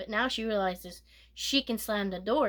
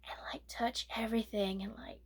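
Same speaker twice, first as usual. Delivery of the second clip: grossed-out whisper; 9 dB quieter than usual. Real, not acted. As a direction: whisper it, repulsed.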